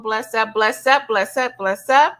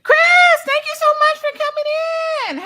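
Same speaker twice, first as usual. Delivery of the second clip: high, louder cry of thanks; excited welcome; 3 dB louder than usual. Real, not acted. Streamer crying out thanks to a viewer, excited.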